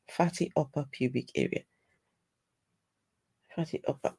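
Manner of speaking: soft unvoiced whisper, memorising the phrase; whispered to herself. Real, not acted. Repeating the new term to herself, whispering. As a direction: breathe the words out to yourself.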